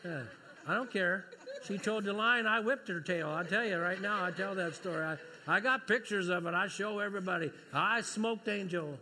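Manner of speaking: loud, high, laughing brag; gleeful boasting. Real, not acted. Father bragging to the congregation about beating his daughter, gleefully loud. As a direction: crow about the win with glee.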